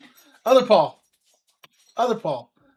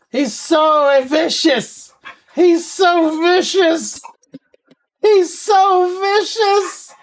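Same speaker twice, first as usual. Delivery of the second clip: slow cooing to the dog, going higher with each repeat; mock baby talk; 8 semitones higher, slower than usual. Streamer coos at his gentle pit bull, mock baby talk. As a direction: tease the sweet dog like he's a tiny beast.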